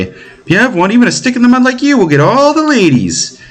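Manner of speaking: put-on high exasperated girl's voice; high-pitched sister correcting her dense brother; indignant little sister. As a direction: scold him like a fussy kid sister. Voices Mishka teasing.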